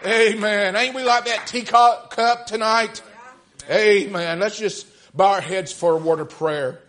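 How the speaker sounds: loud, high revival exhortation; rally the congregation with a ringing shout; full-force preaching. Real, not acted. Preacher rallies congregation to stand.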